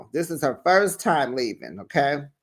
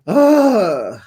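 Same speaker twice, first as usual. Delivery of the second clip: exasperated ugh; 6 semitones higher, 9 dB louder than usual. Real, not acted. Fed up with the show's drama.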